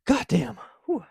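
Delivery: high, loud god damn of pleasure; high, loud delighted curse; loud relish. Real, not acted. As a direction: savour the hit out loud. First sip of energy drink.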